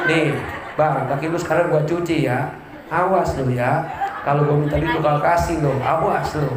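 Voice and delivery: low, teasing mock threat to her husband; threatening wife. Preacher voices a wife washing her husband's feet, low mock threat. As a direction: play the wife half-joking as she warns him. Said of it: "Tapi jangan ngomong macam-macam".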